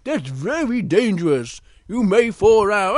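high drawn-out no, then a firm warning; Big Bear. Acted. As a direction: warn the little one urgently. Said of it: "Big Bear goes".

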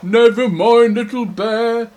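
lightly voiced big bear soothing a little one; broad, hearty consolation; consoling bear. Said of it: "And he goes".

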